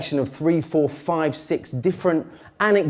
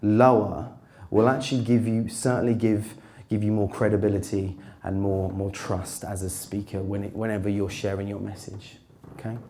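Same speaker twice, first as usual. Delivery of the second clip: demonstrating a lower, softer voice; a deliberately lowered, quieter register; 6 semitones lower, 6 dB quieter than usual. Shown as a demonstration. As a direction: bring the pitch down to sound credible. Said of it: "trying to bring your voice down".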